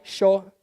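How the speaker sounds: sho with a sh for the Spanish y; sho, hushing sh at the start; sheísmo. Shown as a demonstration. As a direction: say yo as sho, Argentine style. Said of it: "everything that has double L and Y becomes a sh".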